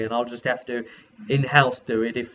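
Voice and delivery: usual speaking voice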